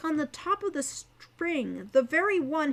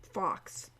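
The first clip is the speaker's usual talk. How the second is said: softer, lower quiet correction; 4 semitones lower, 6 dB quieter than usual. Acted. Al quietly correcting the others about his name, soft. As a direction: correct them gently under the breath. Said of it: "Al corrected them softly".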